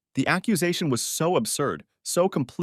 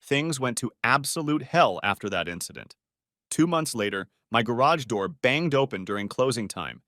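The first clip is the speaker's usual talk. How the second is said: usual speaking voice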